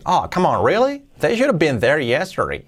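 incredulous, "really" leaping high; acted disbelief. Teacher acts out a reaction for learners, incredulous. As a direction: play exasperated surprise with swooping pitch. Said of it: "la entonación".

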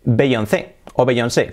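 "Beyoncé" as bey-on-THÉ, then bey-on-SÉ; Spanish-style bey-on-THÉ or bey-on-SÉ; Spanish pronunciation. Shown as a demonstration. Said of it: "aquí en España decimos".

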